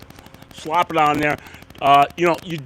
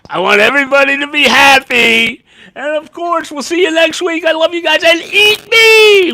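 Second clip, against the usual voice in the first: higher and much louder, building to a shout; excited shouting sign-off; 13 semitones higher, 14 dB louder than usual. Real, not acted. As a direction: shout your goodbye to the fans with joy. Loud excited sign-off to viewers.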